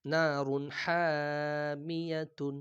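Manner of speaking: slow chanted নারুন হামিয়াতুন; drawn-out recitation. Shown as a demonstration. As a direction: stretch নারুন হামিয়াতুন like tilawat. Quran reading drawn out.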